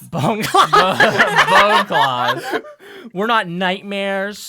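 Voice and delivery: high, loud breaking into laughter; laughing. Real, not acted. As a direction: lose it laughing mid-line. GM cracking up mid-character.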